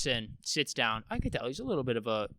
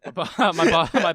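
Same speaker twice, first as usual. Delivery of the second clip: laughing; 4 semitones higher, 7 dB louder than usual. Real, not acted. Laughing while starting the story.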